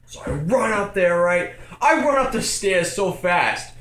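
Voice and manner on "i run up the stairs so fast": usual speaking voice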